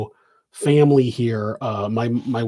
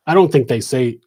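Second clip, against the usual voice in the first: ordinary delivery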